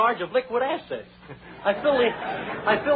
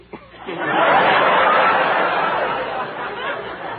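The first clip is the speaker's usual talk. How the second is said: hearty laugh; 3 semitones higher, 5 dB louder than usual. Real, not acted. Big laugh after joke.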